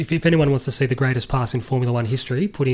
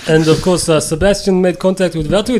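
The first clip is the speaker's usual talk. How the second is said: loud, higher put-on Austrian boss voice; Niki Lauda impression; 5 semitones higher, 7 dB louder than usual. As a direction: bark it out like Niki Lauda with a heavy accent. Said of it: "I'll try to answer it as Niki Lauda".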